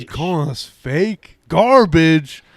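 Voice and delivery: high, indignant questioning, ending loud; drawn-out incredulous challenge; offended disbelief. Real, not acted. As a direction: echo the insult back, outraged. Co-host reacts to the insult.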